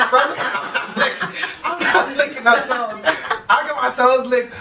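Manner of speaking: high, laughing protest. Real, not acted. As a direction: giggle through a mock refusal. Laughing at toe licking.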